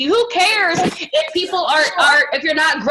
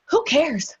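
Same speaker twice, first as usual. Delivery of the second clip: ordinary talk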